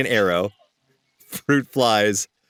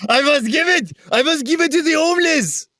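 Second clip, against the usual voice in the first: much higher, over-the-top French snob; lofty French aristocrat giving away bread; hammed French accent; 13 semitones higher than usual. Mocking snobbish Parisians, high theatrical French accent. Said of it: "You sound like a pretty good Frenchman".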